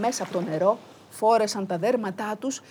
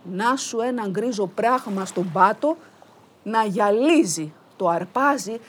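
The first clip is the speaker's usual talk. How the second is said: plain reading voice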